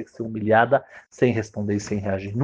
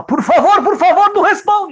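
high, loud, laughing plea; urgent pleading; 8 dB louder than usual. Retold or imitated. Husband begging the humiliated man not to answer. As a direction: beg him urgently not to react.

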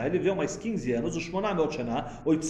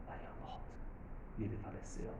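hushed whisper, dropping to a sad murmur; 9 semitones lower, 17 dB quieter, faster than usual. Shown as a demonstration. Rebbe showing how the death is read, hushed whisper. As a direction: let the death fade into a whisper. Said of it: "Como se lê uma paraxá".

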